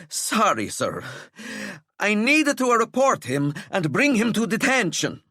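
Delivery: breathless prefect forcing words out; winded and panting. Acted. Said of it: "Apologies for panting".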